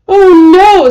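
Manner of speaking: high, loud gasp of dismay; sudden alarmed "oh no"; shocked cry. A narrator's reading. Mother discovering the rainbow hands, shocked cry. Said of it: "said her mother".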